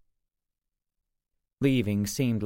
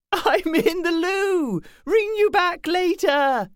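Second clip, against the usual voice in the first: put-on high, slow, chortling British accent; sing-song British accent, very high; 7 dB louder, slower than usual. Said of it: "Mally chortling in a British accent".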